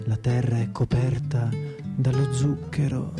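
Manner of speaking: ordinary talk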